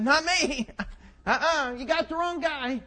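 high, loud protest of innocence; hasty denial. Acted. Peter denies Jesus to a bystander, high protesting denial.

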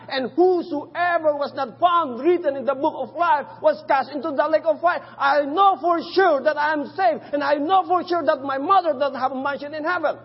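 loud, high, impassioned preaching to the congregation; full-force preaching. Acted.

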